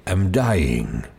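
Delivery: put-on slow, low, frail old voice; feeble old man. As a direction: breathe it out like a dying old man. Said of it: "he said".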